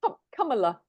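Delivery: "Kamala" as KUM-uh-luh, stress first; pronunciation practice. Shown as a demonstration. Host checking the name, "Kamala" as KUM-uh-luh. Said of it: "like with a U".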